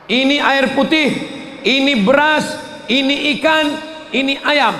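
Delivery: lively host showing off the food; offering food. Pretend. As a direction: hand over each gift to the poor family. Handing food to the poor.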